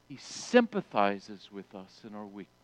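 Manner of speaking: dropped low and soft; softened emphasis. Shown as a demonstration. Pastor pressing the point home to the congregation, low and soft. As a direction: lean in and say it softly. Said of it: "He wants us to understand this point".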